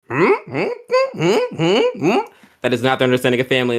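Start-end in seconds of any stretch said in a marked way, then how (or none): 0.1-2.2 string of high, rising questioning hums; short quizzical hums, each going up; puzzled hums. Acted. Dragons baffled by the idea of family, puzzled humming. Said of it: "They're like".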